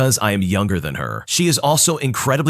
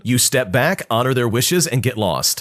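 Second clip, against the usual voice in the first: ordinary delivery, nothing marked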